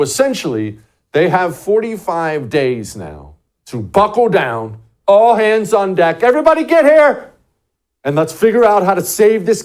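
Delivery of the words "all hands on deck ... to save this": loud, high call to all hands; urgent acted-out summons, pitch soaring; rallying shout. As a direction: shout everyone over in an emergency. Acting an emergency rallying call.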